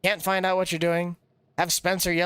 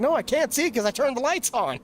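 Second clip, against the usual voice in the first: higher, exasperated reply; imitating himself; 7 semitones higher than usual. Replying to Spencer about the lights, high and exasperated. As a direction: answer high and fed up. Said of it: "I'm like".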